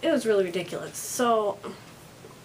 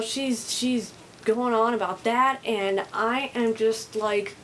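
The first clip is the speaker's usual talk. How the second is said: usual speaking voice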